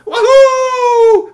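very high, loud cheering whoop; joyful whoop. Acted. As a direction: shout for joy like a delighted child. Robot repaired, everyone celebrates.

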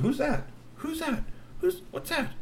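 high squeaky puzzled question; puzzled troll. A narrator's reading. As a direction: ask with high bewilderment.

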